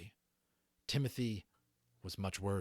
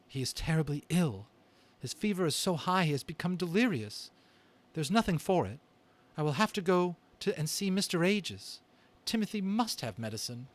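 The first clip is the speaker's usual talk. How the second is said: higher and louder mother mouse, cheerful to comfort her sick son; a mother cheering up her sick child, pitched up and louder; caring mother mouse; 7 semitones higher, 5 dB louder than usual. Acted. Read-aloud story, mother mouse speaks.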